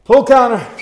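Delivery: loud, sharp coach call over music; shouted command. Real, not acted. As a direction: call it out to the class. Coach calling out boxing commands.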